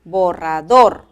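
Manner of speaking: "Borrador" slowly and loudly, final DOR stressed; loud, slow teaching demo, bo-rra-DOR in "Borrador"; last-syllable stress. Shown as a demonstration. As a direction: land hard on DOR in "Borrador". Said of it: "en la última sílaba".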